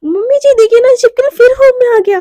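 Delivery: high, loud mock-frightened call; calling out loudly. Shivani fakes fright to call parents, loud high. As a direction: yell for help over a lizard.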